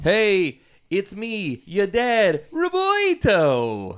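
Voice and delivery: high, drawn-out goofy dad. Pretend. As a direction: ham up a cheesy dad intro. Joking intro as dad.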